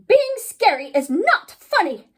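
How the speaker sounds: high, loud, rattled insistence; nervous principal. Acted. Miss Emma Grave facing the giggling ghoul, high nervous scolding. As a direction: insist on it loudly, losing composure. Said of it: "Miss Emma Grave looked pretty nervous".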